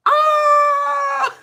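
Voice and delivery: very high, loud drawn-out scream of joy; ecstatic scream. Retold or imitated. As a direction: shriek like you lost your mind. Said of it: "it's just like".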